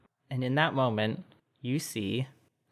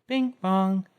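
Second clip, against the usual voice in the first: sung two-note chime; ding-dong like a system bell; chime sound; 6 semitones higher than usual. Acted. Game master imitating the chime, bright two-note bell.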